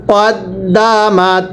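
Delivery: কদ্দামাত drawn out slowly, short ক then doubled দ্দ; slow spelled-out Quran reading. Shown as a demonstration. Teacher models কদ্দামাত slowly for the class. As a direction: stretch each sound of কদ্দামাত, doubling the দ. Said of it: "মিলিয়ে পড়বেন".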